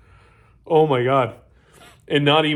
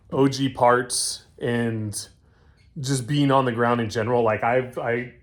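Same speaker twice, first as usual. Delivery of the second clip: normal talking voice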